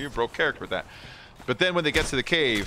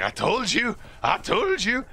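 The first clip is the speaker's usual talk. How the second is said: much higher, sing-song told-you-so; high whiny gloating; 10 semitones higher than usual. Retold or imitated. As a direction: needle him in a high wail. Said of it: "He's like".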